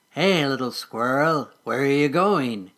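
slow sly voice from the shadows; sly mole voice. Acted. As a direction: lure the squirrel in slowly. Mole lurking in bushes.

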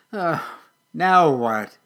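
loud, exasperated grumble; groan, then grumble it loudly; grumbling. Acted. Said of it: "he grumbled".

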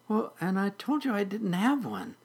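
high little kitten talking back. Acted. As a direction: answer back in a small, high voice. Kitten answers back to Weedle.

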